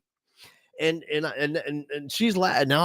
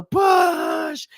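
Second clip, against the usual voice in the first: long, high, drawn-out push; stretched high yell; birth-coach shout; 4 dB louder than usual. Acted. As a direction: urge them through labor. Imitates coaching someone through labor.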